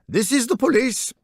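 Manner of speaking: lightly voiced German policeman, high and polite; a touch of a polite officer at the door. Police officer waking a sleepy guest, lightly voiced.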